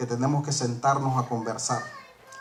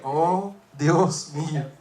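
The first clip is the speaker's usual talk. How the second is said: amazed; much slower than usual. Retold or imitated. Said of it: "Yo dije".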